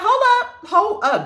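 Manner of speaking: very high mock-indignant protest. Commentator imagining talking back to a friend, mock indignant. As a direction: protest in a high acted voice, easing down slightly. Acted indignant retort to shut up.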